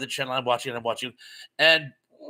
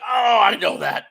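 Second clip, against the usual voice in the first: loud, higher know-it-all shout; cocky shout; 6 semitones higher, 7 dB louder than usual. Retold or imitated. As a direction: blurt it out sure you're right. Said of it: "And he's like".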